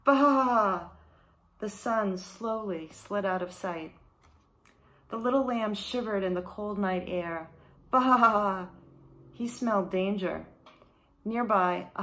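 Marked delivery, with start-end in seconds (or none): 0.0-0.9 loud bleating baa; lamb bleat. A narrator's reading. Lost lamb bleating alone as night falls. 7.9-8.7 loud bleating baa; lamb bleat. A narrator's reading. Lost lamb bleating alone as night falls.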